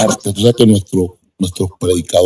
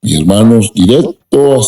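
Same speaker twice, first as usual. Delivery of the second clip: ordinary talk, nothing marked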